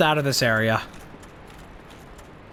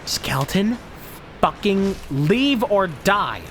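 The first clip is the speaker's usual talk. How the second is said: slow, higher, spelled-out threat; exasperated threat; 5 semitones higher, slower than usual. Real, not acted. Streamer threatening a skeleton in the way, slow and irritated. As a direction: draw each word out at the skeleton.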